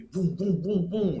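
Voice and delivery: high, punchy boom boom; egg impact sounds. Retold or imitated. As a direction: mimic eggs smacking you. Imitating eggs hitting him.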